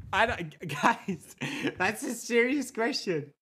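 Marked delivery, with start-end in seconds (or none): none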